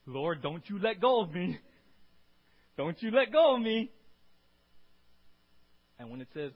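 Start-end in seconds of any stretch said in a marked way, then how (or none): 0.1-3.9 acted desperate cry for rescue; desperate plea. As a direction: plead loudly, building in force. Acts out sinking believer's plea.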